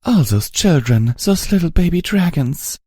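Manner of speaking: high, trembling grief; tearful. A narrator's reading. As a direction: near tears over the children. Velvet grieving over dead dragons.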